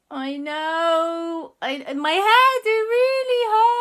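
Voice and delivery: very high, loud, slow whine; high whimpering complaint; whiny aunt. Acted. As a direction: complain in a squeaky, sorry-for-yourself voice.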